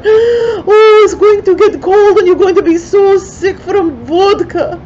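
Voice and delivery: very high, loud, crying mock shout. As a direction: wail at the door so the whole street hears. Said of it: "she put on even little louder".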